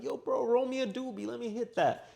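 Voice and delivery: high-pitched mock stoner buddy; carefree bro. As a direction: put on an easygoing high voice asking a pal. Imagined carefree reply to friend.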